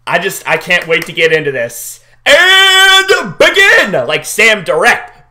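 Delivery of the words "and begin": high, loud, very slow drawn-out "begin"; hype announcement. Acted. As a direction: stretch it like a ring announcer. Announcing the episode start.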